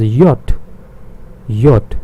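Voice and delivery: yacht said as "yot", crisp final t; yacht as the German name of J, short o; German letter J. Shown as a demonstration. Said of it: "we pronounce it as".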